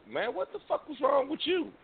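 high, incredulous scolding of a friend; lightly voiced friend, incredulous; mock friend scolding. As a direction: needle him like a buddy would. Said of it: "you was like".

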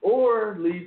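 very high-pitched exasperation; real high-pitched ranting; exasperated rant. Real, not acted. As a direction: tell them off with the voice pushed right up. Exasperated rant telling company off.

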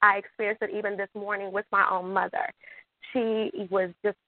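ordinary talk

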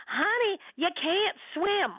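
high, drawn-out imitation of a wife. Wife ending a pool argument with husband, slow high imitation. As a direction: stretch it out as a pointed punchline. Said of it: "Toni said".

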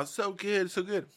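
high-pitched, delighted tasting voice; imitated mother-in-law. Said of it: "and my mother-in-law".

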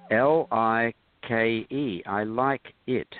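slow, separated letters and words; slowly spelling out. Retold or imitated. Director repeats himself to the puzzled assistant, slowly spelled out.

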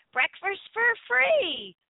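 slow, drawn-out "breakfast for free" rising in pitch; savoring breakfast. Acted. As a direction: stretch "breakfast" out, slow and tasty. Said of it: "I love the way you say breakfast".

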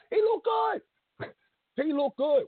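high, slow, drawn-out praise; mock admiring. Host mock-gushing about an old wrestler's looks, high and slow. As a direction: stretch it out in a high swoon.

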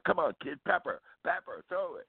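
lightly imitating a young soldier egging on a kid; eager call to play. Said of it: "he's really, like, going".

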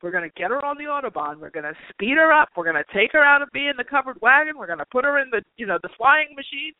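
lightly voiced eager universe; mock excited universe. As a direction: play the universe revving up with delight. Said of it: "the universe is like".

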